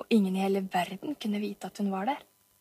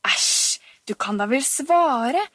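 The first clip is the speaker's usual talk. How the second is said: louder, slow exasperated push; impatient scolding; 3 semitones higher, 5 dB louder, slower than usual. Acted. Oliver to silent Camilla, impatient. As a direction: come on, just answer.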